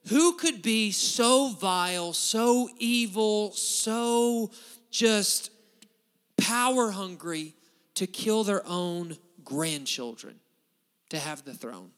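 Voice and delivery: loud, slow, high-pitched preacher's outcry; emphatic rhetorical question from the pulpit; forceful preaching. Real, not acted. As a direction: hammer each phrase out slowly to the congregation.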